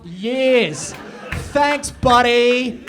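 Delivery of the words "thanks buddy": drawn-out, much louder sarcastic thanks; milk the thanks slowly and loudly. Real, not acted. Comic to audience member, slow loud sarcastic thanks.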